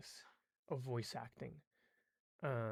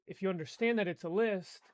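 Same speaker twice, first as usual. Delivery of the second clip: usual speaking voice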